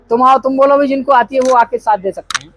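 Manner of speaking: ordinary talk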